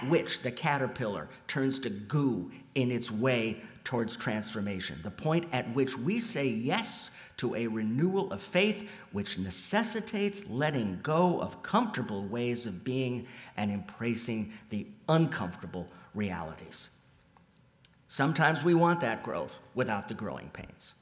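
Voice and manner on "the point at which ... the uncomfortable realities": ordinary delivery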